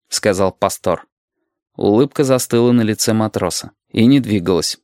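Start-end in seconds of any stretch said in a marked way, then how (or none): none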